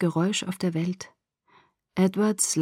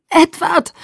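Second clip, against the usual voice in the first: desperate high scream of his name; 10 semitones higher, 7 dB louder than usual. A narrator's reading. Injured girl screaming for Edward, loud desperate cry. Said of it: "schrie ich".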